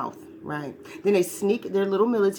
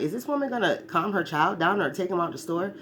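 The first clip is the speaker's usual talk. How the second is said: ordinary delivery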